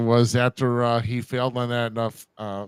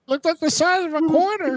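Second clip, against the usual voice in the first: put-on high old man voice, laughing; laughing through an old geezer voice.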